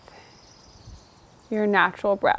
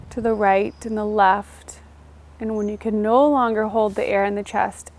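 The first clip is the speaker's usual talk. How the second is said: guided meditation; 3 dB quieter than usual. Acted. Teacher leading a seated class through breathing, soft meditation voice. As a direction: ease the listener into the breath.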